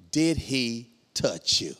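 slow, low, deliberate question; grave questioning. Retold or imitated. Police officer questioning wife, slow and grave. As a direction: space the words out gravely.

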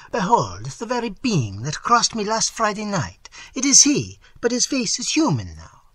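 high-pitched, alarmed exclamation; trembling fear. A narrator's reading. Terrified Italian recognizing the being from last Friday, high alarmed voice. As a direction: raise the pitch high in fearful awe.

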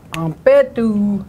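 "Ampetu" drawn out slowly with the stress marked; slow, stressed model word; stress shown. Shown as a demonstration. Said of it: "See where the stress marks are?".

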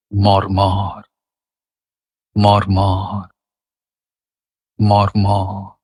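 মর্মর low and evenly drawn out; soft low glide. Shown as a demonstration. Reciter showing wind through leaves, soft gliding মর্মর. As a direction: let মর্মর roll gently in a low register, no stress. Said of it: "অনুভব করে শব্দটা উচ্চারণ করছি".

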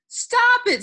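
very high, loud cry; piercing storybook plea to stop; shouted command. Acted. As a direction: cry out to halt a fight. Child breaking up a fight.

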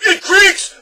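very high shrieked punchline; bellowing. A narrator's reading. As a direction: bellow it, about to collapse laughing. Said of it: "bellowed the Din".

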